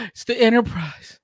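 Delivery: gasping, high, loud mock awe; hammed-up breathless thrill; starstruck tryhard. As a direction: gush like an overeager fan. Mimics over-eager Starfleet tryhards.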